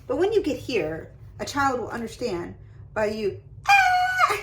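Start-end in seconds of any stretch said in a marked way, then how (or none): 3.7-4.4 high, loud panicked shriek; panicked scream. Shown as a demonstration. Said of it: "giving voice to her reaction".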